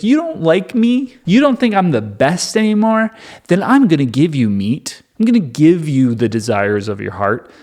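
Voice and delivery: starts very high and wounded, settles into spiteful promise; comic hurt turning into a threat; mock jealous. God, jealous of unhappy people, promises meat in mock pique. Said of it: "And he's jealous".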